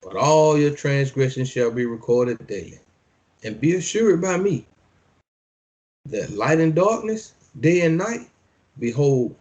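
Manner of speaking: measured preacher's reading; scripture reading. Acted. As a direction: declaim each line like a decree. Reads scripture aloud.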